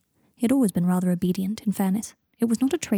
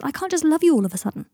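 higher, pleading protest; exasperated protest; 7 semitones higher than usual. Acted. Harry pressed by Draco for love, protesting in exasperation. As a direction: push back, overwhelmed.

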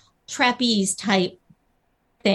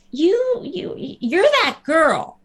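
high, halting, drawn-out realization; imitated fan recognition; 6 semitones higher, much slower than usual. Mimicking strangers who half recognize her, slow dawning excitement. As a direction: stammer up high as it dawns on you.

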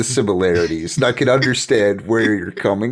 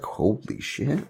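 awed hush; 4 semitones higher, 8 dB quieter, slower than usual. Acted. Boy spies on princess, awestruck.

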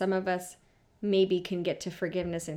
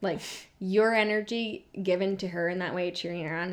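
ordinary talk, nothing marked

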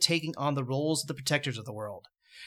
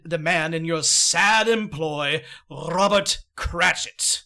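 loud, slow, grand giant; booming giant voice; 3 semitones higher, 6 dB louder, slower than usual. Acted. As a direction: proclaim it slowly and grandly. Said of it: "The giant goes on".

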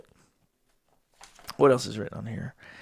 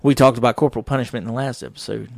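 ordinary talk, nothing marked

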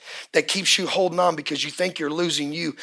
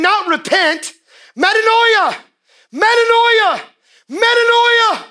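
high, loud cry of metanoia; shouting; 14 semitones higher, 5 dB louder, slower than usual. Retold or imitated. Peter shouts metanoia to three thousand people, loud. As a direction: Peter preaching full voice to thousands.

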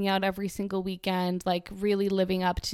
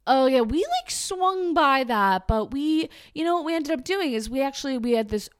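mocking party bro; 5 semitones higher than usual. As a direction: lay it on as a smug partier. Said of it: "these guys would be like".

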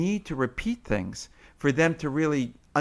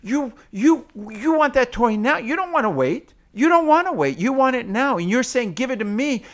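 high, loud, repeated short phrases; toddlerese; 11 semitones higher, 7 dB louder than usual. Shown as a demonstration. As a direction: mirror the toddler's frustration back. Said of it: "you might say".

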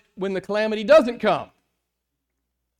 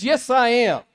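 a lifted, louder voice of God answering; voicing God; 5 semitones higher, 6 dB louder, slower than usual. Preacher voicing God's answer, raised and bold. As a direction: proclaim it as God would. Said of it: "And God says".

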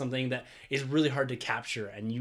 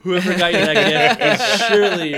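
loud, raised voice breaking into laughs; laughing; 8 semitones higher, 15 dB louder than usual. Real, not acted. Reading the friend's joke about gifts to the co-hosts, laughing. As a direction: read out through laughter, much louder.

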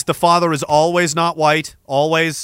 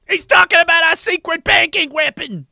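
mock villain henchman; 10 semitones higher than usual. Voiced agents, high far.

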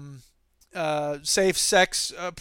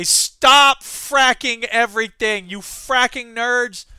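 louder, slow, deliberate telling-off; blunt mock rant; 3 semitones higher, 8 dB louder, much slower than usual. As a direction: spell out the rude version. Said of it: "What a polite way to say".

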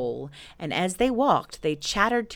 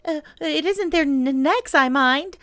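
high, loud, stammering Piglet voice; earnest; 10 semitones higher, 7 dB louder than usual. A narrator's reading. Piglet confides his fears to Pooh, high and earnest. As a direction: insist nervously, tripping over words. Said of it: "said Piglet earnestly".

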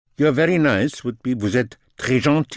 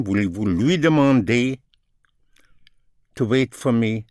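normal talking voice

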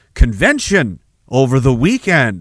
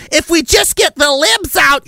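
much higher, slow whiny mock slogan; 11 semitones higher than usual. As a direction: parody a naive voter. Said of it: "How happy are you with that?".

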